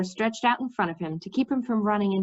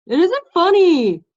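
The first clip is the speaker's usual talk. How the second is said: louder, higher and slow, indignant; hurt protest; 9 semitones higher, 9 dB louder than usual. Acted. Mendembar to a giggling Cimmerian, hurt and indignant. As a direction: insist it is no joke, stung.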